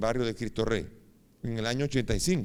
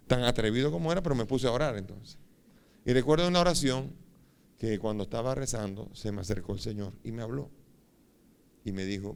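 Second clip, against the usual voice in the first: normal talking voice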